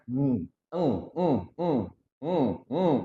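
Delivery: MM as short hummed sounds, no vowel; isolated nasal sound. Shown as a demonstration. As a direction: softly hum each MM with a pause between.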